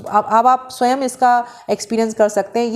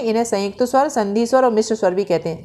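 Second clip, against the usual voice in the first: usual speaking voice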